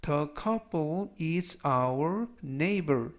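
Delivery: very slow, word by word English; slow model reading. Shown as a demonstration. Teacher repeating a sentence slowly for students to copy. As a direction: spell it out in sound, slowly.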